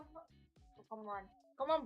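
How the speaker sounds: normal talking voice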